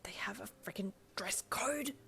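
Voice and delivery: high, whispered hiss of disbelief. A narrator's reading. Arriving in casual outfits, hissing at Darby. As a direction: hiss it under your breath, scandalised. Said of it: "you hissed at Darby".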